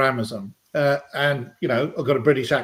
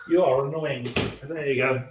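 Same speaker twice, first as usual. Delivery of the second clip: normal talking voice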